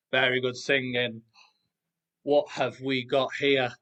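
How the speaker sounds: usual speaking voice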